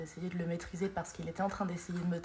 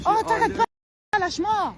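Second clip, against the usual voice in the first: real high-pitched, loud shout to let go; angry shout; 13 semitones higher, 10 dB louder than usual. Real, not acted. Woman to a man who won't let go, angry shout. Confronting men who grab her, filmed.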